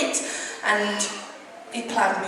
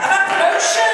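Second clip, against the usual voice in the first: "I've had promotion" called out louder and higher to rouse the crowd; louder announcement; 8 semitones higher, 6 dB louder than usual. Real, not acted. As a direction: call it out again so they cheer. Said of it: "you can do better than that".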